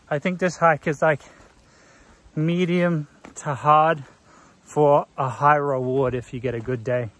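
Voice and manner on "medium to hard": usual speaking voice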